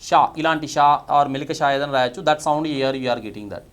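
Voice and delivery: ordinary delivery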